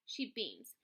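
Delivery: plain reading voice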